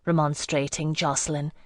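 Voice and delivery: plain reading voice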